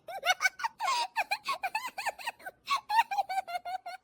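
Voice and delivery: high, giggly laughing fit; long high-pitched giggle; laughing out loud. Acted. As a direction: laugh helplessly at the TV. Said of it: "जोर जोर से हंसने की आवाज".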